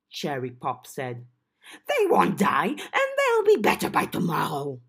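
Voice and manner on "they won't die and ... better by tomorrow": small excitable goblin voice; chirpy little goblin creature. Acted. Goblin Cherry voiced in story.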